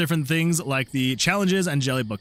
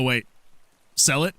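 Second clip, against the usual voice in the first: ordinary talk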